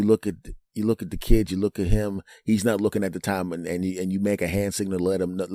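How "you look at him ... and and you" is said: ordinary talk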